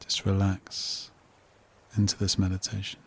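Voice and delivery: soft, unhurried meditation voice with long pauses; guided meditation. Real, not acted. Host guiding listeners to relax, calm meditation voice. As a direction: settle the listener into calm, phrase by phrase.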